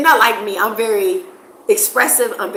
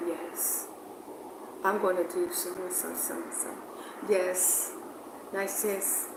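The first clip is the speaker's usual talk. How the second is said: much softer, barely audible, calm and relaxed imitation; soft Tanzanian talk; 3 semitones lower, 17 dB quieter than usual. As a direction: imitate a soft-spoken, relaxed local murmuring yes. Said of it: "they don't talk loud".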